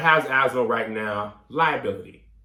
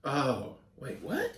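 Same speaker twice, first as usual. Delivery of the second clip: confused surprise; 7 dB quieter, slower than usual. Real, not acted. Confused realisation.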